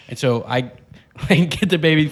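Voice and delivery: normal talking voice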